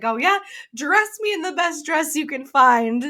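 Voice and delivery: high, sassy challenge; mock defiance. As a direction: order it grandly, out to show them. Retells heroine's defiant order to servants.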